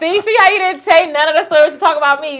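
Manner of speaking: wife teasing husband for leaving out details; laughing accusation. Real, not acted. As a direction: call him out playfully. Teasing partner for missing details.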